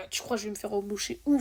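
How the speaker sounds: ordinary talk, nothing marked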